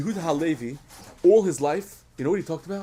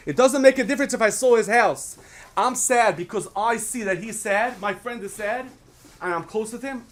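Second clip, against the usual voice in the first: higher, earnest protest from a grieving friend; Yankel pleading; 8 semitones higher, 5 dB louder than usual. Acted. Yankel defends his grief to the man in shul, higher and earnest. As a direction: insist on it, voice rising with feeling.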